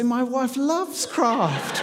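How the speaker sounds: a slow, giggling, tongue-in-cheek claim; laughing fib. Retold or imitated. Says a fib about his wife.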